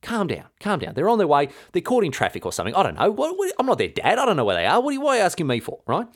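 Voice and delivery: high, hammed-up brush-off of a pestering question; mock exasperated Olga. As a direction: play Olga swatting away questions she can't be bothered with. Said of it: "And Olga goes".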